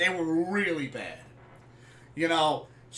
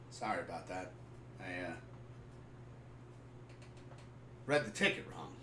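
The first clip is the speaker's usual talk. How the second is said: sheepish apology; 11 semitones lower, 15 dB quieter, faster than usual. Retold or imitated. Dad sheepishly admits his mistake.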